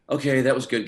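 retold emphatic approval. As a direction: lift it up and out, imitating a convinced director. Casting director's final verdict, retold.

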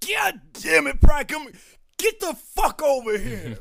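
very high, very loud hammed-up barking yell; drill sergeant yell. Drill sergeant yelling a private over, hammed-up very loud shout.

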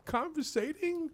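high, soft, halting protest; mock restrained indignation. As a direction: swallow the rage and answer very politely. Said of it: "I'd be like".

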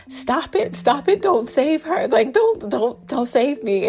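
high yelling stop it at the nurses; mock yelling. Ghost yelling at the nurses to stop, mock high yell. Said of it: "yelling at the nurses".

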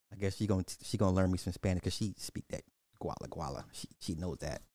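ordinary talk, nothing marked

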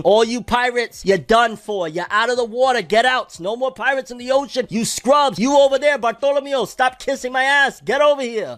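high, loud bossy tyrant voice; arrogant admiral. Retold or imitated. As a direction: bark orders like a smug fleet admiral. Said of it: "he's also saying".

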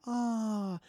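long, held-out imitated "ah"; pitying "ah". Shown as a demonstration. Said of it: "is how the sound".

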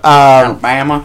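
put-on "Alabama" drawn out slowly in a teasing southern accent; mock southern accent. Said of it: "southern accent when you're saying Alabama".

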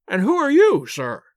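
put-on high, loud, sneering old man; indignant old lord. A narrator's reading. Lord Mount James sneering at the detective, high indignant. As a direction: scoff at the detective's nerve.

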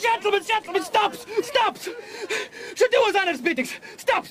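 high, frantic cries of stop; desperate pleading. Acted. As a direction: beg for your life, voice cracking into a sob. Dougal pleads as he is led away to hang.

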